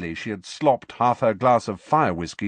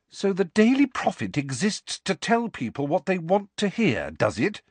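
high, biting rhetorical question; lightly scathing sarcasm; 10 semitones higher than usual. A narrator's reading. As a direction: cut her down with the question. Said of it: "said Hermione scathingly".

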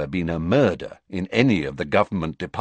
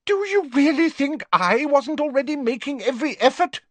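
higher, hot indignant demand; heated demand; 4 dB louder than usual. Acted. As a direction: fire back, stung. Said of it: "demanded Fudge heatedly".

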